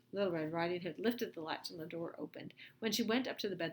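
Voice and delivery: ordinary delivery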